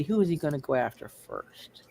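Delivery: ordinary talk, nothing marked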